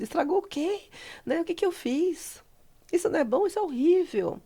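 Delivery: mock-distressed, high-pitched protest; acted agitation. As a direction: replay the fuss in a high voice. Retold agitated exchange on phone.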